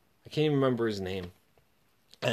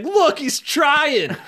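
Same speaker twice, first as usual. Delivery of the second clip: high, slow, loud jeering sing-song through laughter; mocking laughing taunt; 13 semitones higher, 5 dB louder, much slower than usual. Bully jeering at a kid stretching at day camp, loud mocking laugh.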